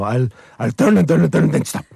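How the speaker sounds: put-on high, fast Eastern European accent for a scheming dog; the dog plotting against his tail in a thick Eastern European accent; dog's Eastern European voice. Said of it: "looked like he thought he would trick his tail".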